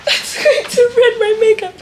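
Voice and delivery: high squeaky laugh-cry; tearful laughing whine. Real, not acted. Woman fretting over makeup, high tearful whine. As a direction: whine it high through tears and giggles. Tears threatening her makeup.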